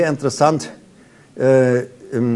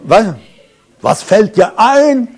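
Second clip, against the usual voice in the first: mock outrage; 6 semitones higher, 6 dB louder, slower than usual. Fake-friendly person turns nasty.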